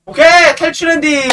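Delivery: high, loud victory shout; excited cheer. Real, not acted. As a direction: celebrate the escape. Cheering escape ending.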